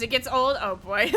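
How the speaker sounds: ordinary delivery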